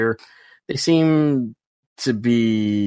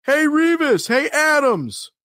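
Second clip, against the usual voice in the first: hailing someone, voice pitched way up and drawn out; calling out; slower than usual. Retold or imitated. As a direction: shout to get his attention from afar. Stranger calling out across crowd.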